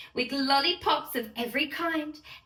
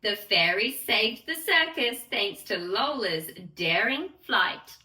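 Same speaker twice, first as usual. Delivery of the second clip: plain reading voice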